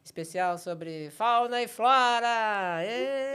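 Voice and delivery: high, loud showman announcement; cabaret host voice. Retold or imitated. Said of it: "eu anunciava as atrações".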